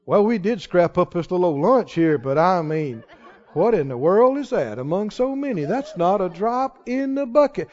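low, soft, doubtful grumble; defeated doubt. Acted. Disciple doubting the little lunch will feed many, defeatedly. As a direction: let the voice sink into hopelessness. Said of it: "Can you hear the difference in the tone".

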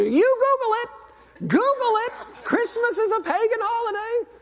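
loud, very high-pitched mock screech; fired-up shrieking. Grandmother yells at the retreating preacher, fired up. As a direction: shriek it like an outraged zealot. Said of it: "And she's getting fired up".

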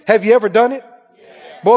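ordinary delivery

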